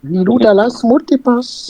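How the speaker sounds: very high movie-character impression; high put-on voice, quoting a film heroine; Lilou. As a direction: squeak out the catchphrase in character. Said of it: "qui arrive et qui fait".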